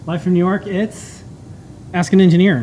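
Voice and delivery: loud show-intro announcement; announcer voice. Acted. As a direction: call out the show title big.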